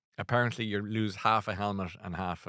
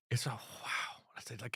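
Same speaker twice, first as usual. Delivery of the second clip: whisper; 10 dB quieter than usual. Real, not acted. Awe at the ocean on screen.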